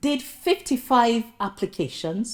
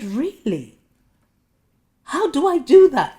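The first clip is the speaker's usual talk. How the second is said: high, loud disbelief; incredulous shout; 8 semitones higher, 8 dB louder than usual. Retold or imitated. Applicant to interviewer, disbelieving loud question. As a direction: throw the question back in disbelief. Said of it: "I said".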